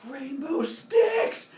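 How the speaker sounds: drawn-out, high awed squeal; excited. Acted. Humans seeing goo drizzled on sticks, excited. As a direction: marvel slowly in a high, silly voice. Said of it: "they get all excited".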